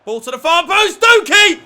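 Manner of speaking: loud, high yell building to the name; rising excitement. Real, not acted. Gamer yells as the cross finds Doki, excited shout. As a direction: call it as he scores.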